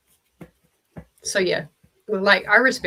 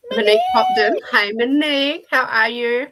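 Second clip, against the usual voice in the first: high, loud delighted hello; light up as a friend arrives; excited greeting; 5 semitones higher, 6 dB louder than usual. Real, not acted. Streamer greeting viewer Monique joining the stream, excited.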